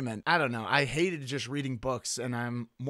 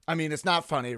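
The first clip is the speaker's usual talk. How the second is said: normal talking voice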